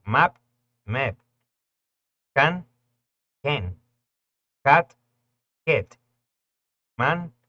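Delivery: map, can, cat, man loud and high, vowel between A and E; loud English a drill; English short a, stressed. Shown as a demonstration. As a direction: hit each word loud with the English a. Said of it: "Presta atención".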